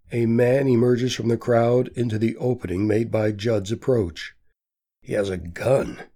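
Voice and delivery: usual speaking voice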